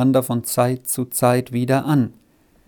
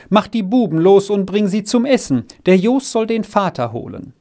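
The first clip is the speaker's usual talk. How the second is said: higher-pitched called instructions; calling out; 6 semitones higher than usual. A narrator's reading. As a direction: call out orders to the child, voice lifted. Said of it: "rief sie ihm entgegen".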